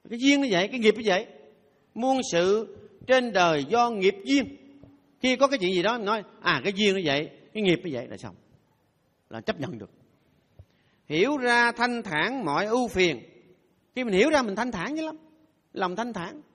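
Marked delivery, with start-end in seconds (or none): 1.9-4.6 slow solemn maxim. Shown as a demonstration. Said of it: "repeats the maxim". 11.1-13.2 slow solemn maxim. Shown as a demonstration. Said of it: "recites a maxim".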